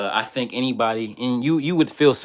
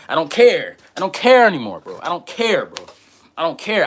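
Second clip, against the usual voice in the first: loud, high "I don't care"; defiant outburst; 6 semitones higher, 6 dB louder than usual. Real, not acted. Venting to his chat, loud defiant outburst. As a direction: throw it out loud and high, fed up.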